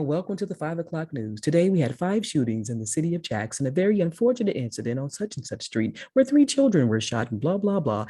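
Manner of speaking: lightly voiced smooth anchor read; a hint of a professional news read; polished news anchor. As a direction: sound like a steady TV newscaster. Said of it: "They're like, you know".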